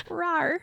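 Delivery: high cute little roar; playful roar. Pretend. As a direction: toss off a silly roar. Roaring back at friend.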